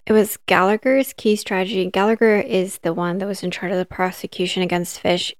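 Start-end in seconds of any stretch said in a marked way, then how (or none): none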